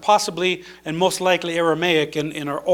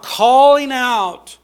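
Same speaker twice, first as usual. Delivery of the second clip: loud, high, drawn-out call; raised voice reaching across a crowd; 5 semitones higher, 6 dB louder, much slower than usual. Shown as a demonstration. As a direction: stretch the words out as a call. Demonstrates the beggar's calling out.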